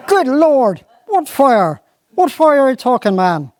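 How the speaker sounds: high exasperated squire; mounting alarm, voice up. Acted. Squire demands to know about the fire, alarmed.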